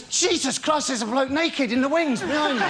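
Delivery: high startled woman's voice, laughing; shocked woman. Retold or imitated. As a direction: squeal it like a shocked marcher. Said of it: "She goes".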